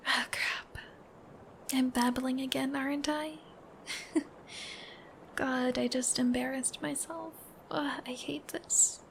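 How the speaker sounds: hushed and self-conscious, half laughing; embarrassed whisper. Acted. Girlfriend scolding herself for babbling, embarrassed whisper. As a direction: whisper it, flustered at yourself.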